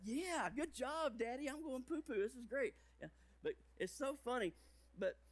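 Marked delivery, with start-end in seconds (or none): 0.0-2.7 put-on toddler cheering. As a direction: cheer daddy on like a delighted toddler.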